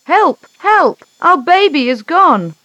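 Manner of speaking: high, loud panicked calls; father yelling that the baby is gone; shouting for help. A narrator's reading. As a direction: cry out for help in alarm. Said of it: "shouted out".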